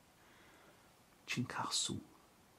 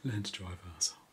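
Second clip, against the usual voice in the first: normal talking voice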